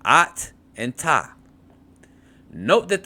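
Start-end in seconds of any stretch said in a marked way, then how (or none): 0.0-1.3 "at and ta" said slowly, syllables apart; split into syllables. Shown as a demonstration. Teacher slowly splitting "at and ta" for the class. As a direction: break "at and ta" slowly into its two syllables. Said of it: "rendered in the following manner".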